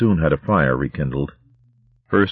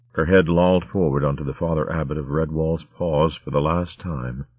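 usual speaking voice

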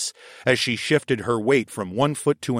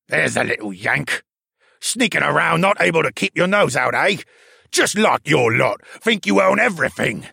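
put-on angry old man; 5 semitones higher, 4 dB louder than usual. A narrator's reading. Baxter growls accusation.

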